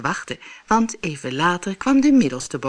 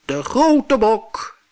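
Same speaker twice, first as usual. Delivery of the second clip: heavy-voiced goat; 6 semitones higher, 6 dB louder, much slower than usual. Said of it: "met zware stem".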